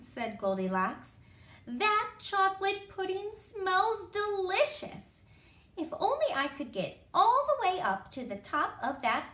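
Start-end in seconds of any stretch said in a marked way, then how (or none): 1.7-9.3 put-on higher, longing storybook girl; a hint of greedy child; lightly voiced little girl. A narrator's reading. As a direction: wish for it in a higher voice. Goldilocks longs for the pudding.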